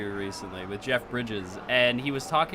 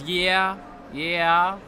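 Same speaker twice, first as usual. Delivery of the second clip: 1 Jeff Bridges yeah; 3 semitones higher than usual. Retold or imitated. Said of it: "he said it in the Jeff Bridges".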